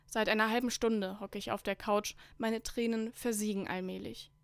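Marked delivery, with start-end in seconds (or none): none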